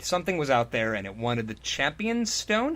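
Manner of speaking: ordinary talk, nothing marked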